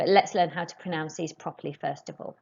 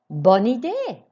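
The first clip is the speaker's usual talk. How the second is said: louder, higher, idée falling firmly; louder, keen "Bonne idée", falling on idée; enthusiastic model; 4 semitones higher, 7 dB louder, slower than usual. Shown as a demonstration. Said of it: "Écoutez et répétez".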